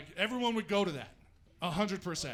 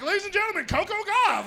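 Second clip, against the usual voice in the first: announcer voice; 11 semitones higher, 7 dB louder than usual. Retold or imitated. Announcer intro.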